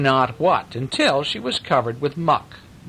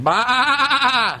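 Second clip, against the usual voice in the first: high loud bleat; sheep bleat; 12 semitones higher, 4 dB louder than usual. A narrator's reading. Reader voicing sheep for children with loud bleat. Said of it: "And the sheep were going".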